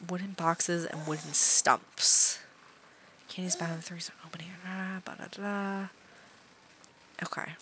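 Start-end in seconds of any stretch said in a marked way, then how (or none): 0.1-5.9 hushed, voiceless whisper; soft breathy murmur under the breath. Real, not acted. Quiet with baby next to her.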